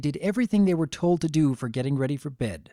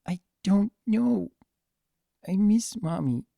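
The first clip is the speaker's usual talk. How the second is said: tearful; 7 semitones higher than usual. Acted. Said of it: "tears growing in his eyes".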